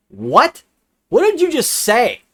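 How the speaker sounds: a sudden high, loud "what?" of disbelief; you can't believe what you just read; baffled disbelief. Real, not acted. Baffled by the quoted claim.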